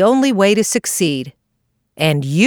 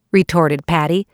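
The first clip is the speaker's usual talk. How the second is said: ordinary delivery, nothing marked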